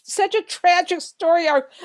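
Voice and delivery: high, slow lament. Real, not acted. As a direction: mourn the story arc. Lamenting character's fate.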